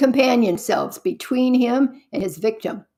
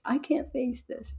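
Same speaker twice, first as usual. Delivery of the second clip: much softer, withdrawn repeat; quiet despair; 4 semitones higher, 8 dB quieter than usual. Retold or imitated. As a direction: let it sink to a hush. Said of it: "reminds me of myself".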